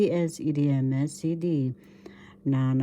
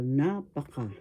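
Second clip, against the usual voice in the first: napaka with NA stressed, falling away; teacher modelling napaka, accent on NA; stress on first syllable. Shown as a demonstration. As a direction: say napaka, leaning on the opening syllable. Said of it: "The accent is in the first syllable".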